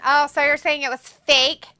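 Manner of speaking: usual speaking voice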